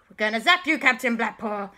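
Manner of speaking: loud threat. Acted. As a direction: call out the threat to the villain. Repeated louder threat.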